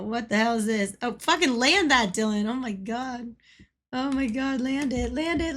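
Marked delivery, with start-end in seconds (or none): none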